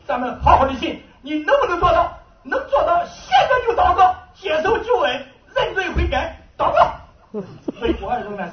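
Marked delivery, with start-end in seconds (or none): 0.1-6.9 high, loud, rising urgent exhortation; fervent call to pray. Retold or imitated. A sick husband urging his wife to pray, high and loud with fervour. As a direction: push her to pray right now.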